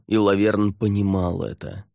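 usual speaking voice

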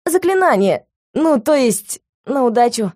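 frightened student caught by the master; pitiful scared excuse. A narrator's reading. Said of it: "жалкими и испуганными".

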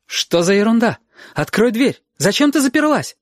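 высокий испуганный крик матери; сердито требуй открыть; гнев и страх. Acted. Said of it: "в её голосе был гнев и страх". (мама в гневе и страхе)

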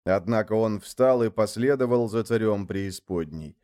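usual speaking voice

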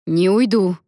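loud, insistent refusal; firm refusal. Acted. Alen won't leave labouring Lia, firmly insisting. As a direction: stand your ground.

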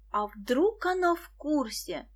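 slow, uneasy question; uneasy shiver. A narrator's reading. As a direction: ask it with a touch of dread. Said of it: "поёжилась Виктоша". (поёживаясь, с опаской)

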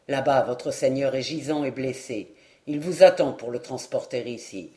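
a small man checking a rival, low and steady; the dwarf warning. Acted. Dwarf to the eager knight, low calm warning.

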